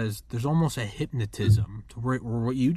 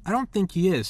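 incredulous retort; 8 semitones higher, 4 dB louder than usual. Retold or imitated. Retorting to people dismissing Brady.

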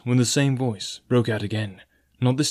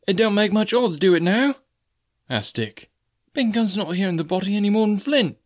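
scoffing; 10 semitones higher than usual. Acted. Dick scoffing.

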